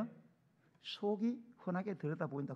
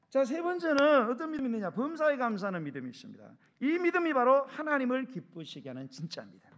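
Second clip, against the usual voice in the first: loud, raised pulpit voice; preaching at full force; 4 semitones higher, 8 dB louder than usual. Real, not acted. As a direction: drive the point home, high and forceful. (힘주어 외치는 설교)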